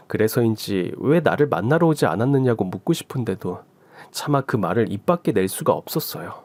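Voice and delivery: usual speaking voice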